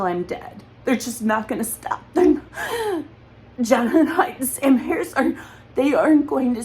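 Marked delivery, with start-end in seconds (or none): 2.1-6.6 real tears breaking through the words; crying out. Real, not acted. Accusing Jonathan Haidt and Sam Harris while sobbing, voice cracking. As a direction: voice jumps high and cracks into a sob. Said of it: "hard not to cry".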